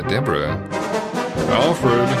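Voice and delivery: ordinary talk, nothing marked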